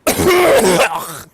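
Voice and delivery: harsh hacking cough with a cold; loud coughing fit. Real, not acted. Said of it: "oh sorry about that".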